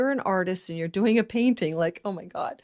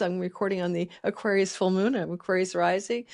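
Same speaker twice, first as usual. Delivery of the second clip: ordinary delivery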